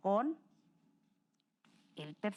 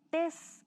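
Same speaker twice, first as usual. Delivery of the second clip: "tes" said alone, higher and pointed; stressed syllable; 6 semitones higher than usual. Shown as a demonstration. Teacher showing students the stressed syllable, pointed. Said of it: "La palabra antes recae la sílaba tónica en".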